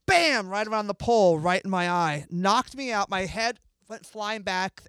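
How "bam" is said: loud, high burst on the hit; explosive retold blow; sudden impact. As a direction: punch it out suddenly. Acts out the sudden punch.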